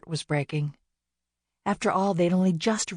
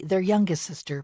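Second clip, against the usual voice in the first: plain reading voice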